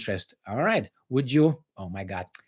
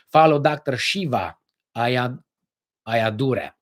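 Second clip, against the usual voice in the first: usual speaking voice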